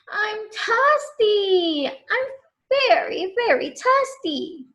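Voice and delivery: put-on high, drawn-out whining child; whiny complaint. Connor complains to the farmer, whining. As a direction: whine like a tired kid. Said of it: "Connor complained".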